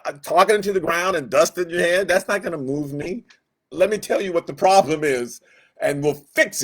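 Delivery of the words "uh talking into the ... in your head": ordinary delivery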